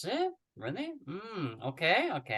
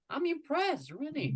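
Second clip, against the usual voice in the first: high, bright-eyed praise; impressed; 10 semitones higher than usual. Real, not acted. Teacher amazed by Sophia's listening, excited praise. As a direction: marvel at the student's skill.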